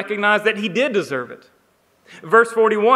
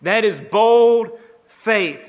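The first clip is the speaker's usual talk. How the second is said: bold stretched out, very slow and louder; slow emphasis; 2 semitones higher, 3 dB louder, much slower than usual. Real, not acted. Preacher driving home the criminal's faith, slow and emphatic. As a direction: lean hard into bold, drawing it out.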